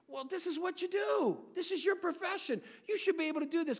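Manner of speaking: high, incredulous exclamation; a preacher's high, indignant cry; indignant outburst. Real, not acted. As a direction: call out their failure in disbelief. Preacher rebukes the failed magicians.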